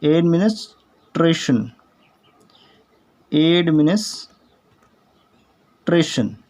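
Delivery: Administration said slowly, syllable by syllable; teacher drags out administration; slow careful administration; slow model pronunciation. Shown as a demonstration. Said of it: "इसका उच्चारण administration".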